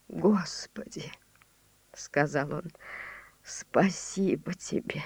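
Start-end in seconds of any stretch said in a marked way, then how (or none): 0.1-1.1 unvoiced awed whisper. Awed thankful prayer. 3.4-5.0 unvoiced awed whisper. Awed thankful prayer.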